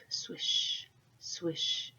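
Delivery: breathy whispered swish, swish like falling leaves. Acted. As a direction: hush it like leaves on a roof. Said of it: "the leaves fell on the roof".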